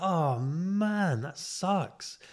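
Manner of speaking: hammed-up sympathy for a sick mate; mock sympathy. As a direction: fake a sorry-for-you tone. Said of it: "I was like".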